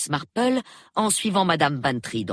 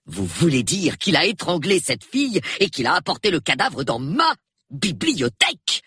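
incredulous indignation, higher-pitched and emphatic; indignant outrage; 6 semitones higher than usual. A narrator's reading. Colonel learns who left the body, outraged and incredulous. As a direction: hammer it out as an outraged question.

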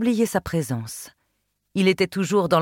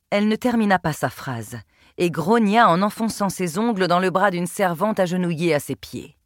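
ordinary delivery, nothing marked

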